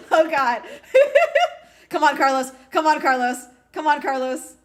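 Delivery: loud, high, laughing; laughing cheer. Real, not acted. Fan cheering Carlos's podium, laughing and loud.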